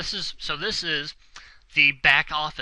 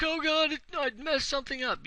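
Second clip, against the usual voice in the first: high-pitched mock panic; mock freak-out; 12 semitones higher than usual. Instructor mimicking a beginner freaking out over setup, mock panic. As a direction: imitate a panicking beginner in a much higher voice. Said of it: "don't start freaking out and being like".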